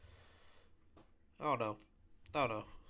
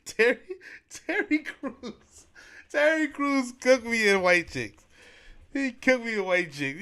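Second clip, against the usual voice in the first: excited; 8 semitones higher, 6 dB louder, slower than usual. Real, not acted. Laughing excitement over the movie.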